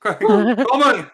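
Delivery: ordinary talk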